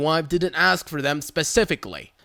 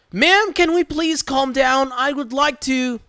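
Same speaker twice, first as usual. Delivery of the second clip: calm her down gently; soothing worker; 7 semitones higher than usual. Retold or imitated. Worker calming Karen.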